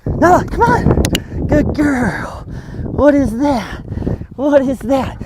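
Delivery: high, drawn-out playful coaxing; exciting dog talk. Shown as a demonstration. Trainer getting the dog to engage, playful and excited. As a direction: tease the dog's curiosity in a high voice. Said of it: "It's just being exciting".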